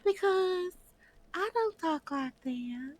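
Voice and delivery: put-on disguise voice. Showing friends nobody will know it's her, high disguise voice. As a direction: pitch it way up and stretch the words. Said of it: "I could talk like this".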